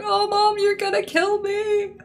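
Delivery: high, slow, fearful whine; a childish, frightened, drawn-out voice; scared confession. Retold or imitated. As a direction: plead with mum, expecting to be in big trouble. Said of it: "and I went".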